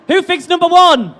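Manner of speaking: rally the audience for a vote; calling out. Real, not acted. Host calls crowd to vote.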